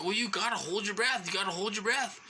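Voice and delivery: imitating a nagging nurse, high and insistent; high-pitched mocking imitation of bossiness; mock bossy nurse. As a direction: mimic a pushy nurse repeating an order. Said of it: "she turned into a bitch".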